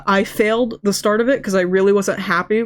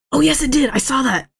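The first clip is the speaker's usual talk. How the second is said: excited; 6 semitones higher than usual. Real, not acted. Reacting excitedly to chat.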